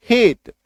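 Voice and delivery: "हेट" with a short closed ay; single-matra pronunciation. Shown as a demonstration. Teacher reading H-A-T-E for the class, short ay "हेट". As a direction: keep the ay in "हेट" light and brief, as in hate. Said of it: "single एकार की मात्रा".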